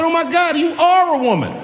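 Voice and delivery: high, startled gasp of discovery; shocked realization. Retold or imitated. Comic realizing the fighter is a woman, shocked high voice. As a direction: blurt it out in sudden shock.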